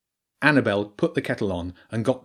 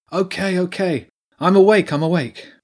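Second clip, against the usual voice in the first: waking up flustered; 8 semitones higher, 6 dB louder than usual. A narrator's reading. Woken early by dog.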